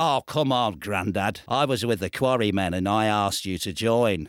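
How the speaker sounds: a lightly voiced weary Beatle; [name] impression.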